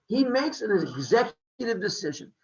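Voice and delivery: ordinary talk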